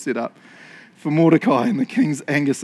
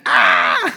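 loud mock-horrified "ah"; shocked yell; 9 semitones lower, 7 dB louder than usual. Imagining the shocked reaction.

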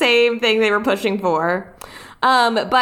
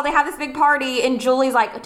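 ordinary delivery

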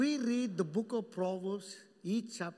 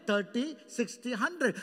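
ordinary talk, nothing marked

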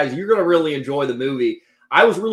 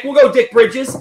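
higher, louder name reveal; proudly announcing a stage name; 9 semitones higher, 7 dB louder than usual. Shown as a demonstration. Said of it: "I already have the name".

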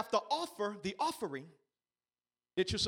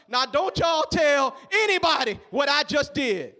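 Jesus warning; 3 semitones higher, 10 dB louder than usual. Retold or imitated. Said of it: "he says to us".

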